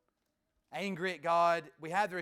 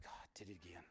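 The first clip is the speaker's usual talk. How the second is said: hushed confession; 22 dB quieter, much faster than usual. Acted. Confessing to God.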